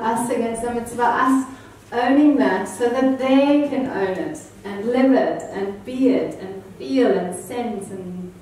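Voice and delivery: usual speaking voice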